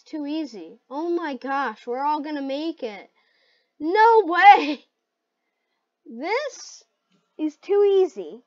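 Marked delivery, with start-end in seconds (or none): 3.8-8.4 shouted amazement; stunned gamer outburst; loud disbelief. Real, not acted. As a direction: exclaim it in shock, much louder. Game disbelief.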